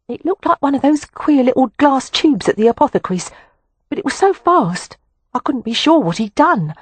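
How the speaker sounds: put-on a servant girl afraid to speak up; nervous housemaid. A narrator's reading. A housemaid nervously reporting to the lord of the house. Voices frightened housemaid Sylvia.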